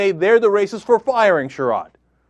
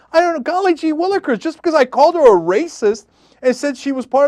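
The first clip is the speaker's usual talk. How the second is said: much higher, squeaky, fast mock of a squirming liar; mocking weasel voice; 10 semitones higher, 3 dB louder than usual. Host mocking Breitbart weaseling out of the Sherrod smear, squeaky high mock. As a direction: ham up a panicked weasel wriggling out of blame. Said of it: "try to weasel his way out of".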